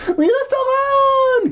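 very high, loud pleading scream; desperate shout. Acted. Cassie screaming at the hermit while running, very high and loud. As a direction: scream at him to go away. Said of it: "Cassie cried".

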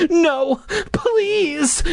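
high frightened pleading; begging. Acted. Noah begged the masked monster, frightened pleading. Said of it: "Noah begged".

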